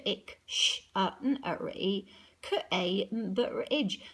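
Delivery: spelling Cambridge out sound by sound, one at a time; sounding out letters. Shown as a demonstration. Teacher spelling out "Cambridge" sound by sound for the class. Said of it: "So just remember the D".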